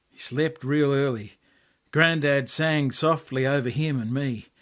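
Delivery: ordinary delivery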